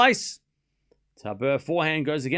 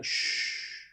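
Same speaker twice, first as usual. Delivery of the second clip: hushing shh; 7 dB quieter than usual. Real, not acted. Hushing spoilers.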